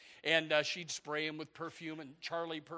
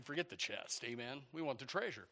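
ordinary talk, nothing marked

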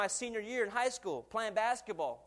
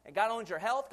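usual speaking voice

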